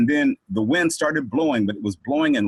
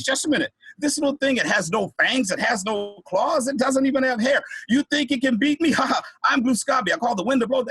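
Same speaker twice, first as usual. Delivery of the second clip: high-pitched, incredulous hero's boast; theatrical mocking bravado, pitched high; scornful boasting; 10 semitones higher than usual. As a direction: scoff and brag at a puny challenger. Boastful hero mocks tiny baby challenger.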